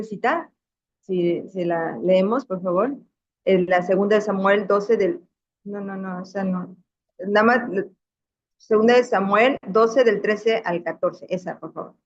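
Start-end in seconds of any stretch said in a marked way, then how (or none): none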